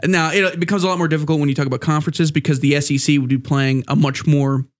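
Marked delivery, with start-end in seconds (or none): none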